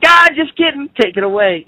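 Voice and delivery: squeaky high, teasing "just kidding"; a high, flippant take-back; mock God taking it back. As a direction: play God as a prankster snatching the gift back. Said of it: "then be like".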